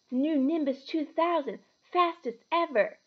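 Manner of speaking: put-on high-pitched, wondering boy's voice; awed young boy. A narrator's reading. Boy at shop window admiring broomstick, awed.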